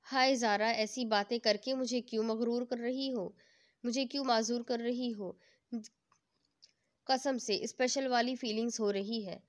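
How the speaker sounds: ordinary delivery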